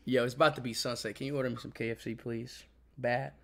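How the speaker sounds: low, soft begging; quiet pleading. Real, not acted. Asking a companion to order KFC, quiet pleading. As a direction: plead under your breath, low and weak.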